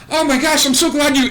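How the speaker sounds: high, eager nerd gushing; nerdy excited voice. Acted. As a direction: squeal it out like a flustered nerd. GM voices nervous nerd Barry.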